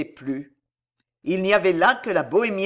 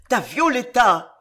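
louder, higher roar; 5 semitones higher, 6 dB louder than usual. A narrator's reading. Farnèse roaring at the woman, loud and high. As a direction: roar her daughter's name at her. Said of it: "rugissait Farnèse".

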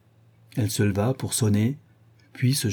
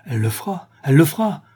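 dismayed outburst, louder and higher on the repeat; exclaimed; 5 semitones higher, 3 dB louder, faster than usual. A narrator's reading. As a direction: exclaim it, building the second time. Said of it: "s'écria Charles".

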